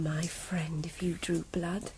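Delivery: soft unvoiced whisper; whispered grim approval of the wound. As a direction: barely breathe the words. Grieving sister speaks of killer.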